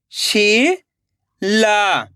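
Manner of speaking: Sheila split into शी, long pause, ला; rising शी, then falling ला; syllable by syllable. Shown as a demonstration. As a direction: sound out Sheila very slowly in two parts. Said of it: "एक एक अक्षर का उच्चारण करना है".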